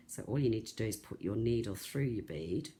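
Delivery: ordinary delivery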